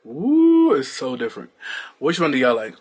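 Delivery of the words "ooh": high, loud excited ooh. Real, not acted. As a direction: squeal with delight. Reacts to the wide angle.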